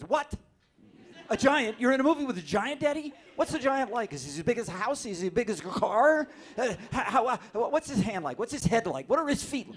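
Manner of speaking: put-on high, loud, breathless little kid questions; excited child voice. Kids peppering daddy about the giant, high and excited. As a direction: gush like a small child thrilled about a giant.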